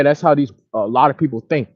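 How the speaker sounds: usual speaking voice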